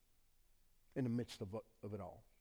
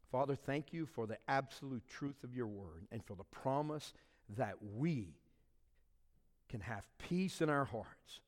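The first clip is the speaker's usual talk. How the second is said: prayer; 3 semitones lower, 4 dB quieter than usual. Acted. Pastor praying over the congregation, soft and reverent. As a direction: pray it gently over the room.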